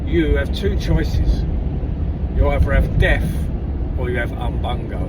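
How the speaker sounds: a hint of a booming chief. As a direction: lay out the choices like a solemn chief. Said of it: "the chief looks ... he says".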